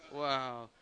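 ordinary talk, nothing marked

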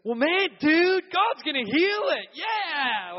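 loud, high "God's gonna heal it"; excited high shout. Retold or imitated. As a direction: hype him up like a pumped-up dude. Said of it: "He goes".

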